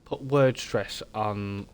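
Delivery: normal talking voice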